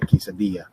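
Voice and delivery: QUESADILLA ending in "dee-ya"; no L sound in QUESADILLA. Shown as a demonstration. Teacher repeating the model, QUESADILLA with a Y sound. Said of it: "two L's make a Y sound".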